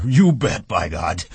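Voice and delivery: delighted burst, high and loud then dropping; happy cry. A narrator's reading. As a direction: burst out with childlike pleasure. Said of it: "he cried happily".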